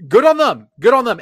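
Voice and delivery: loud, high, hyped repeat; fired-up approval; excited cheer. Real, not acted. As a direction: cheer it like great news.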